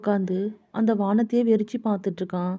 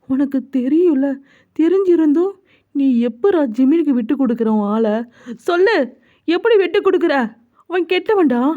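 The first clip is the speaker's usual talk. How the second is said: heated, raised-pitch scolding; angry confrontation; 4 semitones higher, 3 dB louder than usual. Acted. Friend berating Jungkook over his silent love, angry and insistent. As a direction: demand answers from a friend, voice climbing.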